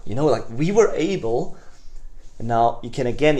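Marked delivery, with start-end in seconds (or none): 0.5-1.5 enthusiastic. Shown as a demonstration. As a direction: lift "were" with excitement. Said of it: "something that is wow".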